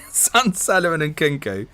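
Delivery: ordinary talk, nothing marked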